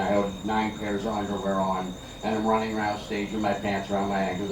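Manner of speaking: ordinary talk, nothing marked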